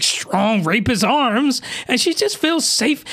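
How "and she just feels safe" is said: normal talking voice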